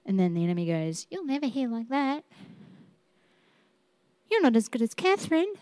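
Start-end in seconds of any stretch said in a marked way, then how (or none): 1.1-2.2 much softer, quick, taunting enemy voice; preacher voicing the enemy's quiet taunt. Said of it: "That's how he sounds now". 4.3-5.5 much softer, quick, taunting enemy voice; preacher voicing the enemy's quiet taunt. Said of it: "That's how he sounds now".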